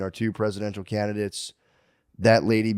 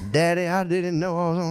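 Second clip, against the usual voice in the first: put-on higher southern girl's voice; southern daughter; 6 semitones higher than usual. Caught daughter explains herself to Daddy, southern accent. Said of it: "if she's got that accent".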